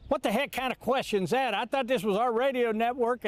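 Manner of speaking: high-pitched, indignant retort; retold angry outburst without shouting; imitating a mad coach. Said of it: "he was, like, mad".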